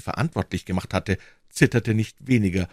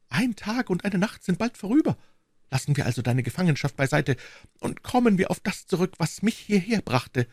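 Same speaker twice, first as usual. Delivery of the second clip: put-on high, businesslike young wife; wife brushing off her husband's complaint; brisk wife; 12 semitones higher than usual. A narrator's reading. Brisk and businesslike.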